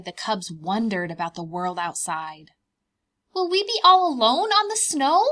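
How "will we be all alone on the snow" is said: high, louder little cub asking. Acted. Bear cubs asking Mama, high child voice. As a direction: pipe up like a curious bear cub.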